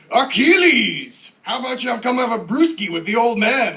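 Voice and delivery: mock-epic high, loud shout, name stretched; hammed-up theatrical bellow; bellowing dad. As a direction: call a kid to dinner like a movie warlord. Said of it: "what if a kid nowadays was named".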